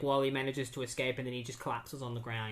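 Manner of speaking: ordinary talk, nothing marked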